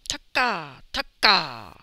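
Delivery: loud, drawn-out lizard cry; punched first syllable, long open ending; lizard call. A narrator's reading. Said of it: "A long-tailed lizard".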